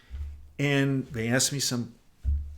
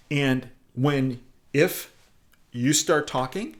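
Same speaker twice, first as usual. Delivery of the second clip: slow, louder, each word pressed; pointed warning; 3 semitones higher, 4 dB louder, much slower than usual. Retold or imitated. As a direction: lay down the rule to the committee. Lecturing the committee chairman.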